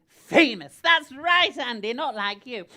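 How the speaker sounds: loud, high burst of bragging; triumphant boast. Pretend. Bragging to Andy about being famous, loud and triumphant.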